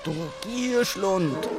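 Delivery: slow storybook snarl; growling lion. A narrator's reading. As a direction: scold as a lion, drawing it out. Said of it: "knurrte Löwe".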